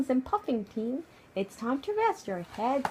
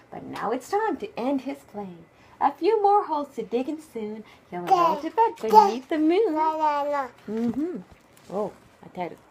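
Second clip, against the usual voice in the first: ordinary delivery, nothing marked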